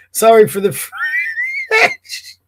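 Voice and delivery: a laughing shriek breaks the apology; bursting out laughing. Real, not acted. Apologising to viewers for swearing, laughing. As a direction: apologise but crack up.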